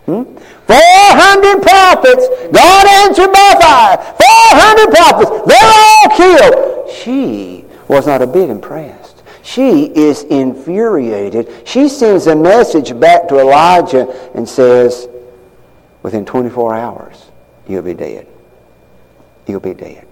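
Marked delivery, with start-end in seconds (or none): 0.7-6.7 high, excited telling of news; excited report. Retold or imitated. Husband Ahab breathlessly telling his wife Jezebel, high and excited. 16.0-20.0 low, soft menacing threat; quiet threat. Retold or imitated. Queen Jezebel sending Elijah a death threat, low and soft.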